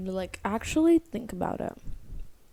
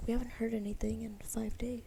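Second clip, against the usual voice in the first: whisper; 12 dB quieter than usual. Retold or imitated. Silent creepy movie, quoted whisper.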